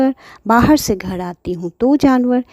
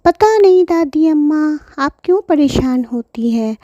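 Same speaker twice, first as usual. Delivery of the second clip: put-on higher childlike reply; child voice; 7 semitones higher than usual. Pinky answers her mother, higher childlike voice. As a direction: answer like a little girl.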